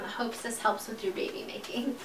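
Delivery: whispered note reading. Real, not acted. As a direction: whisper the card so husband can't hear. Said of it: "reads note in a whisper".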